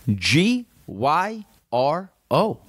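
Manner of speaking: G Y R O, each letter spaced and slow; spelled out slowly. Shown as a demonstration. Host spelling the word for listeners, slow and spaced. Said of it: "I'm gonna spell it out right now".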